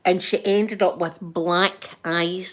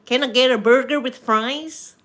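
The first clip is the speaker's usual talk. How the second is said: higher-pitched put-on American accent; 4 semitones higher, 3 dB louder than usual. Shown as a demonstration. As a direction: order politely in a mock American accent. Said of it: "That's my American accent".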